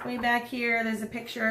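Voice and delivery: ordinary talk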